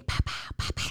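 softly puffed banging noises; imitated banging. Co-host mimicking the banging that answered the investigators, percussive. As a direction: mouth quick percussive knocks without voice. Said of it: "they get some loud banging".

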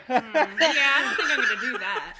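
loud, laughing brush-off; dismissive refusal. Retold or imitated. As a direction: turn it down with a laugh. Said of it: "I'm just like".

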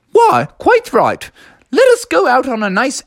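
a hint of a stately English nanny; Mary Poppins.

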